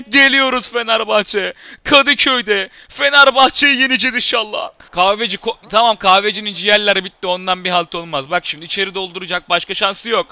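normal talking voice

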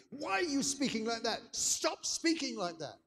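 put-on high-pitched, indignant child's protest; furious little girl. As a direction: act out an outraged small daughter, stretching the last demand. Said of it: "I've never seen her so angry".

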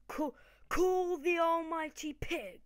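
slow, loud, high proclamation; grand summons. Pretend. Gamer summoning his pig Pokemon, grand and loud.

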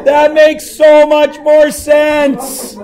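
loud, high, drawn-out realisation; hammed-up exclamation of sudden understanding; mock revelation.

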